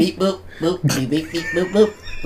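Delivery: high-pitched alien beep-boop chatter; mock alien beeping. Joining the teasing of a friend, high alien beeps. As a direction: join in with silly high robot beeps. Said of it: "Were you speaking to aliens".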